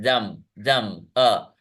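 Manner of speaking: दम said slowly, then its "uh" alone; isolated vowel sound. Shown as a demonstration. Teacher showing a sound with no spelling, दम slowly isolated. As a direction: repeat दम separately, then pull out the अ. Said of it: "लेकिन वहां पे एक sound है".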